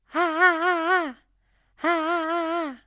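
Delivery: teacher shows a disengaged head-voice ha, high. Shown as a demonstration. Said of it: "this is actually a disengaged sound".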